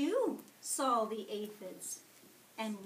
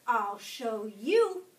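louder, slow, defiant; huffy threat; 9 dB louder, slower than usual. A narrator's reading. Grouchy ladybug vows to prove itself, slow and loud. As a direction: spit out the threat slowly.

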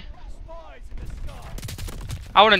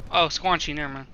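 ordinary talk, nothing marked

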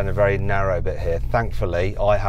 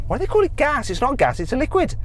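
much higher, incredulous mock outrage; mock indignation; 13 semitones higher than usual. Real, not acted. Puzzled Brit ranting to Americans about the word gas, high mock outrage. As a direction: pitch up in playful disbelief.

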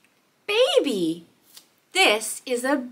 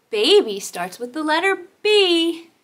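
ordinary talk, nothing marked

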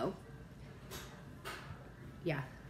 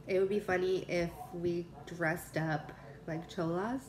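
ordinary talk, nothing marked

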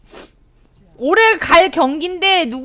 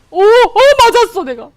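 startled outcry; 8 semitones higher, 8 dB louder than usual. Real, not acted. Got hit by Kai'Sa skill.

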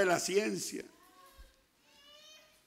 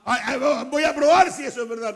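hammed-up doubting believer, loud and high, rushed; mock the one who wants to test it; mock doubter; 7 semitones higher, 7 dB louder than usual. (imitando burlón al creyente que duda)

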